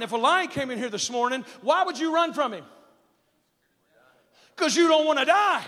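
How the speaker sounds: high, loud pulpit exhortation; full-force preaching. Real, not acted. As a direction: preach it loud, driving each key word home.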